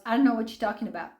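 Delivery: rattled off fast, brushing it aside; fast dismissive mock of the ex-husband's denial; quick flat denial in imitation; rushed denial. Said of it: "And he goes".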